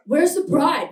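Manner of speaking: mock a bored stripper asking; deadpan stripper. Stripper walks in asking for the bride, loud flat call.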